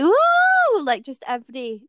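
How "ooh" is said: high, loud swooping "ooh"; long mock-thrilled squeal; imitated crowd whoop. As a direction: whoop like an overexcited cinema audience. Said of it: "it was like".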